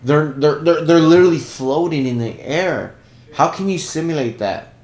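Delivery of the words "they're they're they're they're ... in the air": loud. Real, not acted. Heated argument about zero gravity.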